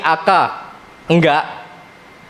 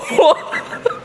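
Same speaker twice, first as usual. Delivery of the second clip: airy laugh with no voice; real, voiceless laughter; silent laugh; 3 dB quieter than usual. Real, not acted. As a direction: shake with laughter without sound. Teacher laughs again.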